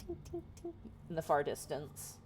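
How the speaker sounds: ordinary delivery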